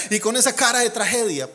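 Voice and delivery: usual speaking voice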